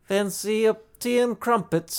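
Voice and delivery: high, slowed mock English accent; a hammed-up Brit offering tea. Joking with his cohost over a beer, mock English accent. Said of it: "All of my English accents turn into".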